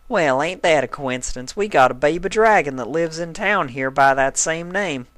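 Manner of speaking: lightly voiced flirting country mare; flirty Applejack. A narrator's reading. Applejack flirting with the stallion, light country voice. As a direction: sweet-talk the handsome stranger.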